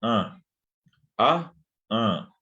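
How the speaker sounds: "A" closed and intense through the nose; intense nasal A. Shown as a demonstration. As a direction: push a strong nasal "A". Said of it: "bien intenso de la A nasal".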